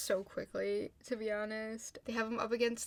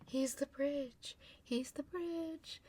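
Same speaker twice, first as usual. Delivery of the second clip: slow, higher, dazed chant-like murmur; 5 semitones higher, slower than usual. Retold or imitated. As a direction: imitate someone in a woozy trance. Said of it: "all, like, out of it".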